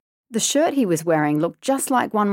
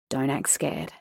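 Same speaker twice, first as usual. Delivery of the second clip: quiet inner warning; 4 semitones lower, 7 dB quieter than usual. A narrator's reading. Inner self-talk, frightened.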